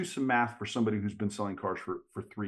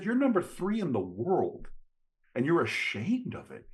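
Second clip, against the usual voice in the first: incredulous; 6 semitones higher than usual. Retold or imitated. Incredulous challenge to Benny.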